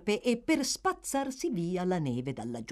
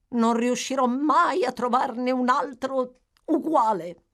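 higher and louder, fretful widow; peevish exclamation; 7 semitones higher, 6 dB louder than usual. A narrator's reading. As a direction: wail it out in petulant self-pity. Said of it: "esclamò stizzosamente".

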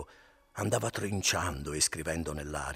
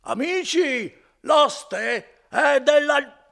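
much higher, very loud, slow drawn-out yell; shouting louder; 14 dB louder, much slower than usual. Acted. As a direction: call to the whole inn downstairs. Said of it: "cominciava a urlare più forte ancora".

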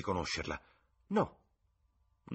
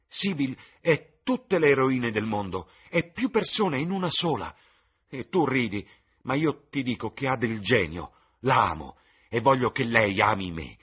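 louder, higher, fevered declaration; passionate, heated proclamation of love; violent excitement; 4 semitones higher, 7 dB louder than usual. Acted. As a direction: pour it out like a lovesick young man. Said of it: "Era in preda a una violenta eccitazione".

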